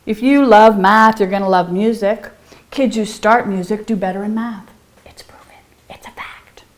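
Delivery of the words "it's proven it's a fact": very soft breathy aside; whisper. Acted. Teacher whispering a fun fact to her viewers. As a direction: let it slip in a hush.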